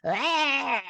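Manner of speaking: high, drawn-out mock screech; imitated troll scream. Recalling the trolls in the movie, mock screech.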